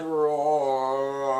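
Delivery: a loud, hammed-up roar; dad's comic roar as he throws his daughter; mock roar. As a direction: roar like a dad hurling a kid. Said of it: "My dad".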